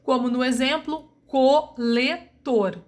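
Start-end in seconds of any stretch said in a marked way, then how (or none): 1.3-2.8 coletor spoken slowly, split co-le-tor; slow classroom syllabification; syllable by syllable. Shown as a demonstration. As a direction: spell out coletor by its sounds. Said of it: "eu tenho quatro vogais".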